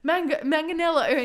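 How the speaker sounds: high, loud mock apology, tripping on the name; flustered stumbling. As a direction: imitate someone fumbling a name. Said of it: "you kept stumbling over".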